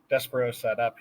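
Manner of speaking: usual speaking voice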